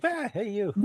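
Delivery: high little mock attention-grabbing cry; calling for attention. Teacher telling student how to interrupt, playful high call for attention. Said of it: "just be like".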